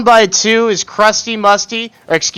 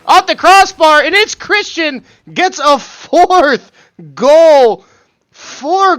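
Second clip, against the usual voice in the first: excited goal call; 9 semitones higher, slower than usual. Real, not acted. Caster calls a hat-trick goal.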